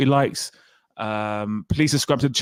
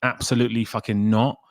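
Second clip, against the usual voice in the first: ordinary talk, nothing marked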